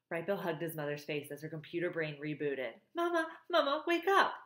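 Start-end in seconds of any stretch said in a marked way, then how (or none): 3.0-4.3 put-on high, urgent child's cry; urgent gosling. A narrator's reading. Gosling rousing his mother, urgent high cry. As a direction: plead for Mama in a high little voice.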